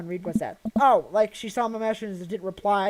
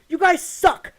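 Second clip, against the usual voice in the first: angry outburst; 7 semitones higher, 5 dB louder, much slower than usual. Retold or imitated. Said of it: "I'm very angry and really embarrassed".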